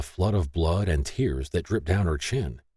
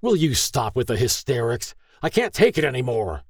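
higher-pitched, moderately raised outburst; angry roar; 6 semitones higher than usual. A narrator's reading. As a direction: roar it at the end of your patience. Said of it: "David roared".

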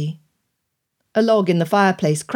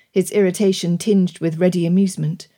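plain reading voice

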